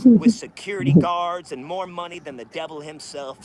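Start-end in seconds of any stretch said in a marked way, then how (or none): 0.0-1.5 loud rant. Real, not acted. Angry rant.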